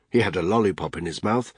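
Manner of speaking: ordinary delivery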